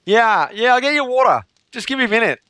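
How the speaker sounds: high mock-Australian accent; Australian waitress. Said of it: "when she finally got it".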